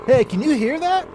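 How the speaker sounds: high, fast nervous customer; high nervous diner customer. Acted. Customer character in improv.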